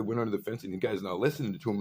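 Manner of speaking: usual speaking voice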